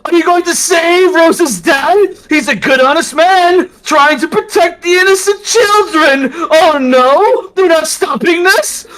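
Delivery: high, loud mocking rant; gloating mad priest. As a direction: taunt god in triumph. Harrow gloats at god.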